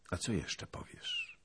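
soft, patient question; mild princely tenderness; gentle. A narrator's reading. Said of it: "łagodnie książę".

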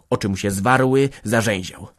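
usual speaking voice

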